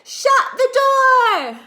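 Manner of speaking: very high, loud, slow desperate shout; frantic shout. Acted. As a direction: yell it as the cat escapes. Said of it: "And then I shout".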